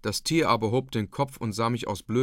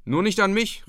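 high, louder, jolly retort; merry call; 8 semitones higher, 5 dB louder than usual. A narrator's reading. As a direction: call it out in good humour. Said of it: "rief ich lustig". (lustig gerufen, hoch und laut)

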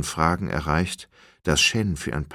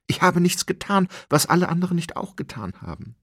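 high, loud, rushing plea of innocence; desperate protest; 13 semitones higher, 4 dB louder, faster than usual. A narrator's reading. Condemned Shen pleading his innocence to Hong, high and loud. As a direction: cry out your innocence, words tumbling fast.

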